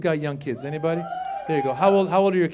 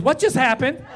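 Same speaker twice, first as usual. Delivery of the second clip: put-on higher-pitched child's question; inquisitive daughter; 7 semitones higher, 4 dB louder than usual. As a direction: imitate a sharp little girl asking. Sharp daughter questions dad at once.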